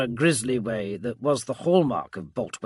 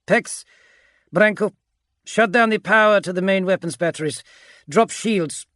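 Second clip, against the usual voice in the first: raised, higher, louder commanding voice; giving orders; 8 semitones higher, 6 dB louder than usual. A narrator's reading. Agapito directs his legionaries at the consoles, giving orders. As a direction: issue battle orders. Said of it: "Agapito ordered".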